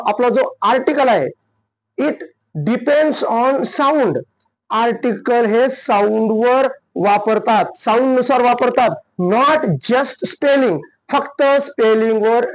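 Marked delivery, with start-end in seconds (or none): 2.0-4.2 depends on sound, drawn out slowly with weight on depends; slow stressed teaching rule; slow emphatic rule. Shown as a demonstration. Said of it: "watch out". 9.2-10.8 not just spelling, very slow with a hit on not; slow stressed teaching rule; slow emphatic rule. Shown as a demonstration. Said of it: "watch out".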